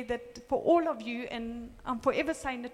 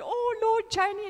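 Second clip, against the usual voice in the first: higher, louder "oh no" reaction; retold dismay; 9 semitones higher, 5 dB louder than usual. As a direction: exclaim it in mock dismay, pitched up. Retold alarm at friend being called.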